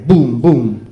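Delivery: loud booming explosion sounds; loud mock explosion. A narrator's reading. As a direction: boom it out like a blast.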